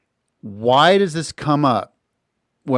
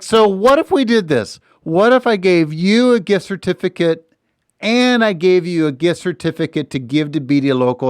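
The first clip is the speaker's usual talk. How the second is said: put-on New York accent; 6 semitones higher than usual. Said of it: "a little bit of a New York accent".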